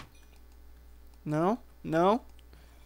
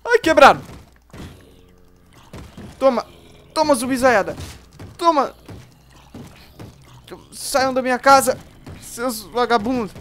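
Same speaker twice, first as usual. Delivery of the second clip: shouting while fighting; 3 semitones higher, 7 dB louder than usual. Real, not acted. Fighting zombies in house.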